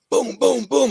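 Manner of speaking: loud booming knocks in words; imitated banging. Storyteller banging on the wall to wake him, loud imitated thuds. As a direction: pound out each boom.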